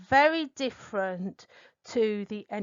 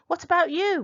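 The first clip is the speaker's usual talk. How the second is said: "What about you?" raised high and loud; stress on "about"; 7 semitones higher, 5 dB louder, faster than usual. Shown as a demonstration. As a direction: lift the whole question up and hit "about". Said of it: "the phrase "What about?".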